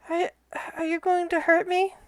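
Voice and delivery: high, soft little creature voice; timid flying monkey. Acted. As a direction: plead softly as a frightened little monkey.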